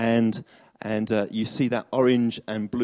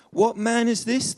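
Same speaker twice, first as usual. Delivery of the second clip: amazed question; 11 semitones higher, slower than usual. Acted. Disciples amazed.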